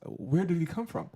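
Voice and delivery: puzzled at a deer on the sidewalk; amazed question. Retold or imitated. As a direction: ask it in retold disbelief. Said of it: "I was".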